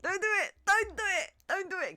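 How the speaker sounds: high, loud pleading shout; desperate don't do it shouts; yelling at the screen. Real, not acted. Yells at the game scene.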